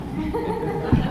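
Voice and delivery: soft breathy laugh through tears; embarrassed teary laugh. Real, not acted. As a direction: laugh it off while welling up. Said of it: "why am I getting emotional".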